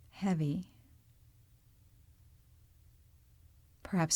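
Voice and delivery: calm, unhurried meditation voice with long pauses; guided meditation. Real, not acted. Yoga teacher leading listeners into body awareness, calm guided-meditation delivery. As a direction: guide them gently through the body, leaving space between phrases.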